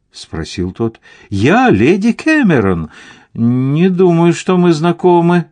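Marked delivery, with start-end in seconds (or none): 1.3-2.9 higher, emphatic announcement; insistent self-introduction. A narrator's reading. Lady Cameron to a count who asked who she is, insistent self-introduction. 3.3-5.5 unregretful dismissal. A narrator's reading. Count to Lady Cameron, denying acquaintance, unregretful dismissal. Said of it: "без особого сожаления".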